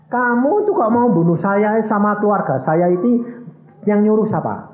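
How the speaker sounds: high, insistent questioning; acted confrontation. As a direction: demand to know who sent them. Acted confrontation with would-be killer.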